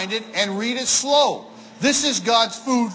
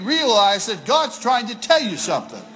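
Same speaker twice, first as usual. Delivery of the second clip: ordinary talk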